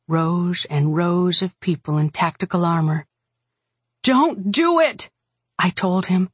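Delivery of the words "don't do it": slow, raised-pitch plea; pleading warning; urgent plea. A narrator's reading. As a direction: beg him to stop, stretching the words. Daughter pleads with betraying father.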